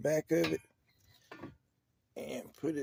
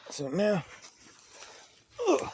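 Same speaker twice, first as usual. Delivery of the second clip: straining while tightening by hand; effort grunt; 8 semitones higher, 4 dB louder, slower than usual. Real, not acted. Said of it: "excuse me for grunting and groaning".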